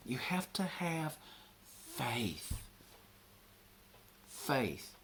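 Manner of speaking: hushed, intense insistence; whispered emphasis. Real, not acted. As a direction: breathe the word faith almost soundlessly. Preacher drops to whisper on faith.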